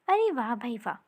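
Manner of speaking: ordinary delivery, nothing marked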